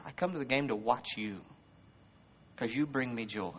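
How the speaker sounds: very soft, low, heartfelt voice; tender reassuring father. Retold or imitated. Father telling his crying daughter he loves her, low and tender. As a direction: reassure gently, with long tender pauses. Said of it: "and you'll hear your father".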